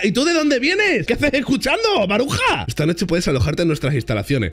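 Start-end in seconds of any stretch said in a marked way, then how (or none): 0.0-2.6 high-pitched mock scolding of an eavesdropper; hammed-up outrage, pitched way up; indignación fingida. (regañina exagerada y aguda)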